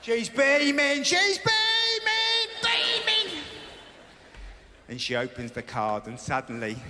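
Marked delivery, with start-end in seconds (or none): 0.0-3.4 high, slow stretched beaming; slow squeaky mock delight; gushing high. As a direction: coo it out as if overjoyed. Mocking wife's joy.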